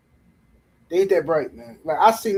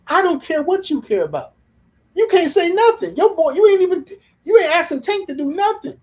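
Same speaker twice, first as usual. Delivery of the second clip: much higher and louder, heated retort; exasperated comeback; 11 semitones higher, 6 dB louder than usual. Real, not acted. Answering Tank fans, loud heated retort. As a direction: fire it back at them, fed up.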